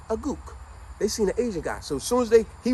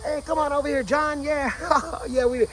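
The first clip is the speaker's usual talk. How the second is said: friendly bar buddy calling over; 10 semitones higher than usual. Retold or imitated. Buddy calls John over at bar.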